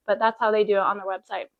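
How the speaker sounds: ordinary talk, nothing marked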